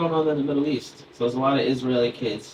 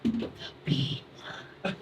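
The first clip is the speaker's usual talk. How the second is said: put-on very high kid voice, dropping softer; Tommy; 3 dB quieter than usual. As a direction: imitate a first-grader explaining a secret code word. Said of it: "He's like".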